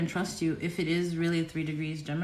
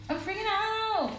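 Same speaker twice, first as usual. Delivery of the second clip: very high, softer panicked squeal; small mock freakout; 13 semitones higher, 5 dB quieter than usual. Streamer winding down her freakout, high soft mock squeal.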